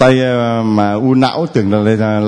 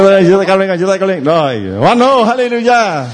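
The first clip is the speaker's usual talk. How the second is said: high, ringing call to the crowd to raise hands; rousing call; 7 semitones higher than usual. Real, not acted. Priest calling on the congregation to raise hands, rousing. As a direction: lift them up with a cheer.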